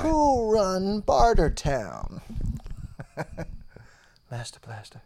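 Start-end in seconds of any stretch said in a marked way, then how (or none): 0.0-2.2 high, loud, very slow movie-trailer call; hammed-up announcer. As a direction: stretch it out like a carnival announcer.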